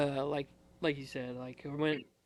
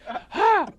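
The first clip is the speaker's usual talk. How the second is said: loud, high burst of a laugh; one big laugh; 10 dB louder than usual. Retold or imitated. Recruit failing to keep composure, one big laugh. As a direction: let out a single explosive "Ha!". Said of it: "let out one really good laugh".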